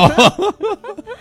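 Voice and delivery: shrill burst of laughter; high squeal of laughter. Real, not acted. Big laugh.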